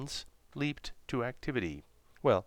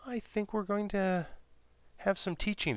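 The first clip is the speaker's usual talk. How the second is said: high Maddie voice; 11 semitones higher than usual. Acted.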